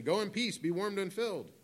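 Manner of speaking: lilting, high send-off; breezy common blessing. Acted. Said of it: "a common blessing that people would say".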